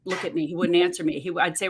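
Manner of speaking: normal talking voice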